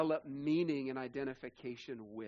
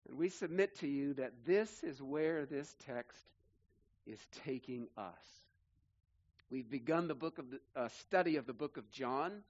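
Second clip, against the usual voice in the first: normal talking voice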